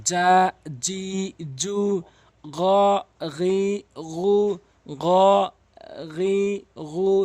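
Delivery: জা জি জু, গ গি গু chanted slowly; slow syllable drill. Shown as a demonstration. Teacher chanting letter drills for practice. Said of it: "এভাবে বারবার প্র্যাকটিস করবেন".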